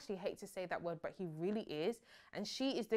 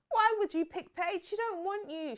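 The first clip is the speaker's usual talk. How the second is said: high, loud exasperation; exasperated; 11 semitones higher, 8 dB louder than usual. Real, not acted. Scolding Jay for picking Paige, loud and high. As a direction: demand it in disbelief.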